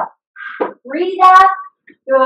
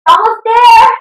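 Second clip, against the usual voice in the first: urging on; 8 semitones higher, 4 dB louder, slower than usual. Real, not acted. Trainer pushing through the last reps.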